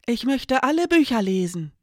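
deutlich höher und lauter, fremde Figurenstimme; höhere Mädchenstimme. Acted. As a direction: als Marademon hell und laut, mit eigener Stimme. Marademon antwortet dem Spiegelwesen. (Marademon antwortet dem Spiegelwesen, hoch und laut)

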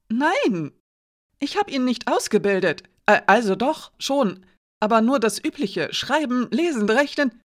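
high, loud, stumbling self-defence; caught-out man protesting too much; flustered denial. A narrator's reading. Flustered denial under interrogation.

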